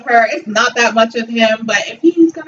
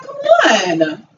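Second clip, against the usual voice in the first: mock exasperation. Mock pleading with the woman.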